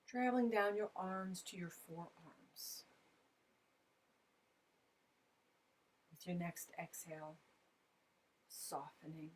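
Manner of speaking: spacious, hushed relaxation cues; guided meditation. Real, not acted. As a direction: guide listeners into relaxation, leaving long silences between short phrases. Leads a body scan meditation.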